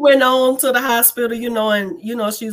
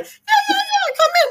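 helpless laughter. Retold or imitated. Patient greeting stunned doctors, helpless laughter. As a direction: squeal it out, dying laughing. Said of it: "crying, laughing... Dying".

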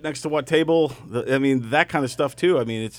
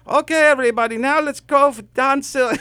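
loud, high emcee introduction; hammed-up stage announcer impression; Saad; 9 semitones higher, 4 dB louder than usual. Saad bringing Don on after the juggler, loud announcing. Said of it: "And then Saad comes out".

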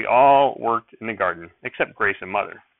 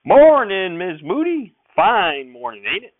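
loud, high rural sheriff holler from a distance; hollered greeting; 9 semitones higher, 8 dB louder, slower than usual. Acted. Sheriff hollers hello to Mother from his horse, loud and folksy. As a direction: call it across the garden like a folksy lawman. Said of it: "Sheriff McGrath hollered".

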